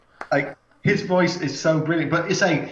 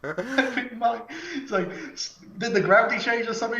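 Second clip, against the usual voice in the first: laughing disbelief; 6 semitones higher than usual. Real, not acted. Laughing at film physics.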